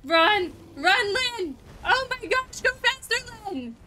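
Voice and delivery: frantic, high urgent calls; urgent yelling. Real, not acted. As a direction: urge them to run. Urging character to flee.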